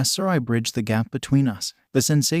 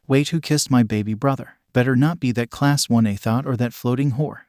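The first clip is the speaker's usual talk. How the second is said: ordinary delivery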